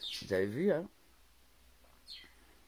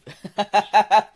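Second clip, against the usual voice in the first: high loud laugh bursting out; real open laughter; 8 semitones higher, 18 dB louder than usual. Real, not acted. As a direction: crack up at the joke.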